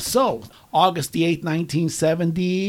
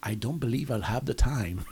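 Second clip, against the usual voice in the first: put-on [name] accent; 5 semitones lower, 6 dB quieter than usual. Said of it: "That's my best [name] accent".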